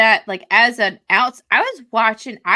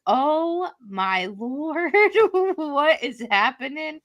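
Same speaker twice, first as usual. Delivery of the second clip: stunned; 8 semitones higher, slower than usual. Retold or imitated. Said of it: "I was like".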